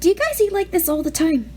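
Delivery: put-on boy asking in awe; nervous teen. A narrator's reading. Peter's teen voice.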